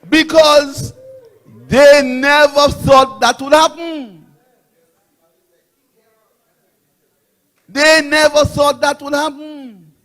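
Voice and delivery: high, slow, very loud declamation; rousing shout. Real, not acted. Party speaker taunts beaten rivals before a crowd, rousing shout. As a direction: belt it out to the crowd, stretching each word. Rally speaker drives point home.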